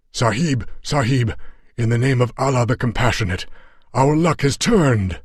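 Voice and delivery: a battered fighter crying out in hope; Afghan warrior Yar Ali. A narrator's reading. Afghan companion voiced in story.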